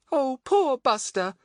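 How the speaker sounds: high, slow, drawn-out pity. A narrator's reading. Betts feeling sorry for the dog left behind, pitying. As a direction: draw it out high, full of sympathy.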